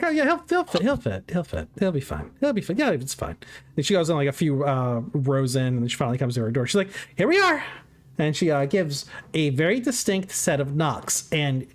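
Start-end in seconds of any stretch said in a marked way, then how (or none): 0.0-3.4 high, quick rabbit chatter, reassuring. Acted. GM voices Amantha reassuring. 7.2-7.6 high, loud rabbit announcement. Acted. GM voices Amantha arriving.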